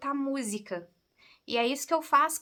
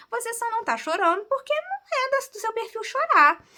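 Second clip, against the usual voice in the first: higher, emphatic retelling of the therapist; quoting the psychologist; 9 semitones higher, 4 dB louder than usual. Retold or imitated. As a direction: land the therapist's verdict with a lift. (imitando a psicóloga, voz mais aguda)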